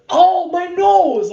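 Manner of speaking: loud, high, slow wail over the nose; hammed-up hurt after the punch; pained cry. Guide crying out after her uppercut, hammed-up pain. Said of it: "He was like".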